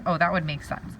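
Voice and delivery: normal talking voice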